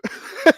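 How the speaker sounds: short high laugh; a quick, amused laugh; burst of laughter. Real, not acted. Amused after joking about health claim.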